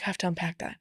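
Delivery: weary sigh. Retold or imitated. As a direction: say it like you're too tired to care. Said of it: "And you're like".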